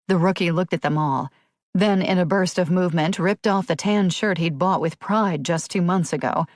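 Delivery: ordinary delivery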